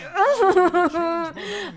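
a helpless laugh at his own mistake; frustrated laugh. Real, not acted. Gamer laughing at his botched play, helpless laugh. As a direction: laugh it off, exasperated.

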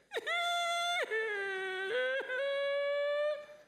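high, loud hammed-up crying wail; mock wail. Preacher mocking a wife who cries, drawn-out mock wail. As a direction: let out a long fake sob. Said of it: "mocking a crying person".

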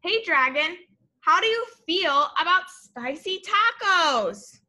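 lively, sing-song storybook read-aloud; bright read-aloud for little kids; reading to children. A narrator's reading. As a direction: read it like a picture book to a class.

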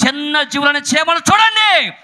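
rising to a high, loud, stretched call; ringing, drawn-out sermon cry; preaching exhortation. Real, not acted. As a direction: call the congregation to look.

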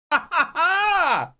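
high, stretched "ha ha ha" laugh. Acted. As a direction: cackle high, holding the last ha. Mock cheering over game score.

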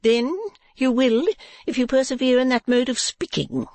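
high-pitched wifely warning; Madame Mantalini. Acted. Wife warning her husband about his talk, high voice. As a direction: warn in a high wife's voice.

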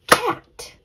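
"cat" punched high and loud; clapped-out syllable. Shown as a demonstration. Teacher clapping out the syllables, "cat" in one beat. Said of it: "Let's clap out the syllables".

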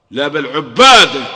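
slow, loud correction; forceful cry. Real, not acted. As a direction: thunder out لا بل عباده. Preacher correcting himself forcefully.

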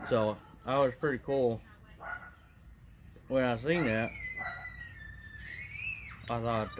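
talking around pipe stem; pipe in mouth. Real, not acted. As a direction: teeth clenched on pipe. Seen 1: Pipe stem held in his mouth through all labelled frames.

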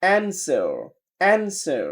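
American "answer" with AE and final R; American accent. Shown as a demonstration. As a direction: drill "answer" American style, AN-ser. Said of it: "American বলে". (American উচ্চারণে)

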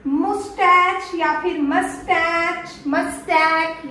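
"मुस्टैच", "मस्टैच" ending in ch or k; common mispronunciations. Shown as a demonstration. Trainer listing learners' versions, mispronunciation. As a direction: slowly offer "मस्टैच" with hard endings, not sh. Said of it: "यही बोलते हो ना".

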